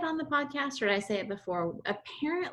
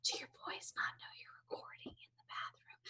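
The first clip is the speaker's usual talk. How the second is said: whisper; 16 dB quieter than usual. Real, not acted. Asks about secret bathroom recording.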